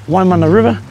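usual speaking voice

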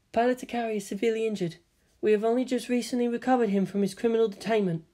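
put-on soft, fast, emotionless girl; quiet flat girl voice. A narrator's reading. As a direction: report it with no feeling.

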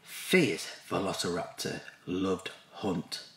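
ordinary delivery, nothing marked

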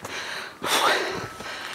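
winded, breathy exhale; long puff from exertion; heavy sigh. Real, not acted. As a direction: blow out a long tired breath. Winded on uphill walk.

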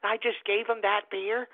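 lightly voiced mother, quiet and miffed; mock offended mother. Host imitating a mother listening at home, mild mock indignation. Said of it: "mothers out there like".

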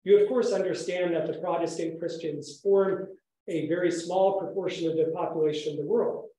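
ordinary delivery

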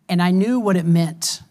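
ordinary talk

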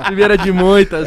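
loud high laughter into words; laughing loudly. Real, not acted. Friend laughing loudly at the baby's first song. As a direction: laugh while cheering them on. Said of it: "laughing at the song story".

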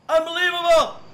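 full outraged shout; exploding in disbelief. Real, not acted. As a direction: yell it out, fed up. Escalating repeats of unbelievable.

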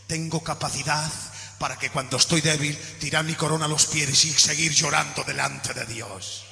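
low, soft voice of a broken-hearted king; David, tender and firm. Acted. David's long reply to Joab.

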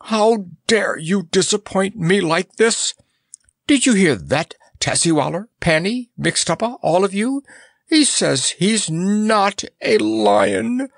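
high-pitched indignant ranting; choked rage. A narrator's reading. Monarch rails at his court over the false lion, in high choked rage. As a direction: rant high, close to sobbing with rage. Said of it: "A sob of rage choked Mustafa's voice".

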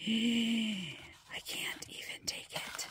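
slow awed whisper after a gasp; gasp, then breathe it out slowly; overwhelmed, hushed delight. Real, not acted. Overwhelmed by the pen.

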